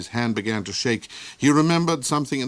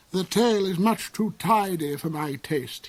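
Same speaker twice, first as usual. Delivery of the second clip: snarl; 8 semitones higher than usual. A narrator's reading. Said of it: "he snarled".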